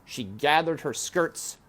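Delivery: usual speaking voice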